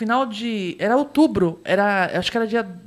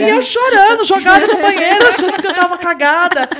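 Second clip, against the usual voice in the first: high, loud laughing telling; laughing loudly; 7 semitones higher, 7 dB louder than usual. Real, not acted. Telling friends the embarrassing story, laughing loudly.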